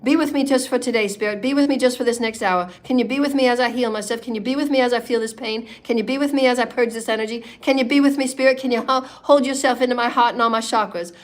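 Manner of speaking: pleading prayer. Acted. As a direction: call on Spirit, pleading line after line.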